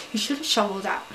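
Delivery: normal talking voice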